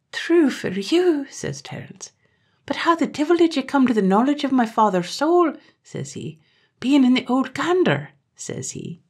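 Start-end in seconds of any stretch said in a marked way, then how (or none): 0.1-1.3 shaken agreement. Acted. 2.6-5.5 frightened question. Acted. Terence, frightened, questions Ger. 6.8-8.1 frightened question. Acted. Terence, frightened, questions Ger.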